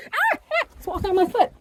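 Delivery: real high yelp of fright, then shaky complaint; startled scream. Real, not acted. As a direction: shriek as the bird touches you. Bird lands on her foot.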